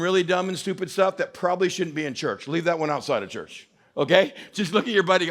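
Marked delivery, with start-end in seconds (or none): none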